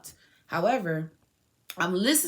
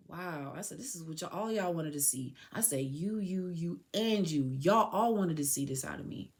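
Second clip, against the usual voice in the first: soft, low retold accusation; hushed, bitter disbelief; stunned resignation; 4 semitones lower, 7 dB quieter than usual. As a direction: drop the volume, let it sink in. Subdued reaction to her arrest, retold.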